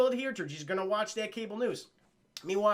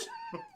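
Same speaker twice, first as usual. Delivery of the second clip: squeaky laugh; 12 dB quieter than usual. Real, not acted. Laugh after bit.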